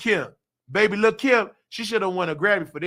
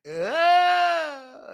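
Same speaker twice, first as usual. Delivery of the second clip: mock wail; 7 semitones higher than usual. Mocking Kim's wailing at funeral.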